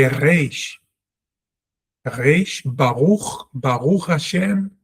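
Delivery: Germanic-sounding Israeli Hebrew accent; guttural R in an Israeli accent. Shown as a demonstration. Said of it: "That's the Israeli accent".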